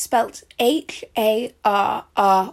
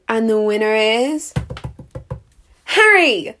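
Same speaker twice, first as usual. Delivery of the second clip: winner announcement; 3 dB louder, slower than usual. Acted. Announcer revealing the winner to the crowd, hyped.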